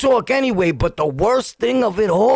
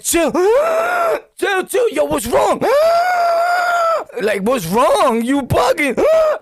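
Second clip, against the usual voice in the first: panicked pleading; 13 semitones higher, much slower than usual. Retold or imitated. Kid begging the brother to chill, high panicked yelps. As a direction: beg him to stop while getting hit. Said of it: "You'd be like".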